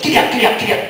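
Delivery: loud, high riding call; high, insistent rider's shout; urging a donkey on. Retold or imitated. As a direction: call out to get the animal moving.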